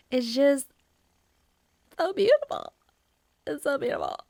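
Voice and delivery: high, swooning gush; awestruck. Real, not acted. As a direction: gasp at the beautiful sky. Awed by the night sky.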